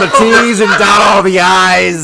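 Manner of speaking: high, loud, slow nagging clerk voice; Monsters Inc. clerk. Retold or imitated. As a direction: drag out the name like a grumpy office clerk. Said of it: "the person from Monsters, Inc".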